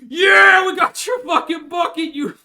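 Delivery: very high, loud gloating yell; hammed-up victorious soldier; mock triumphant shout. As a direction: bellow the taunt at the enemy. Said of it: "They were like".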